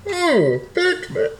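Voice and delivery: high, loud villain voice echoing into a cup; Bane voice. Acted. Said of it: "I didn't know I was gonna do the Bane".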